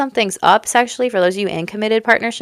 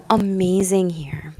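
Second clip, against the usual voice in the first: breathy hushed amazement; almost whisper it in wonder; awed whisper; slower than usual. Real, not acted. Telling Cancer viewers the read is amazing, whispered. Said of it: "I wanna cry for you".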